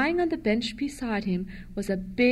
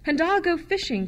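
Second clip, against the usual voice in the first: eager cry; 6 semitones higher than usual. A narrator's reading. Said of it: "cried Jane".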